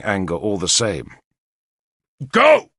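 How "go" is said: furious high roar, very loud. Acted. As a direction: bellow it in rage. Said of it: "he shouted".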